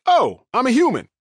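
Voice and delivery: high, wide-eyed Tarzan realizing he's human; Tarzan's discovery. Retold or imitated. Comparing his college arrival to Tarzan meeting humans, high wide-eyed wonder. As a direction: play it as a stunned jungle man's discovery. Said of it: "Tarzan finally met some humans and was like".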